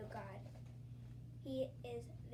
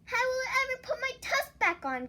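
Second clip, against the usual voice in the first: loud, higher despairing wail; 11 semitones higher, 10 dB louder than usual. Acted. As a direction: wail over the broken tusk. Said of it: "Ganesha wailed".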